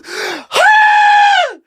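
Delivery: long, high, loud scream; acted scream. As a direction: scream at full pitch as the door opens. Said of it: "I start screaming".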